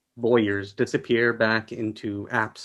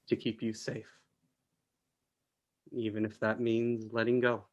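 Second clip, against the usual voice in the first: much softer, letting the words fall away; soft promise; 8 dB quieter than usual. A narrator's reading. Narrator softly promising the listener to keep them safe. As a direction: hush it like a promise to someone you love. Tender promise to loved one.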